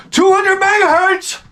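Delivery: high, slow, ecstatic yell of two hundred megahertz; excited yell. Retold or imitated. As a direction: shout it down the street, overjoyed. Yelling through neighborhood on bike.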